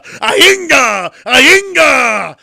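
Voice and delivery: put-on loud, high drunken whoop; mock drunken yell. Mocking the tipsy Rock with a loud high yell. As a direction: hoot it like a drunk. Mocking Rock's tequila again.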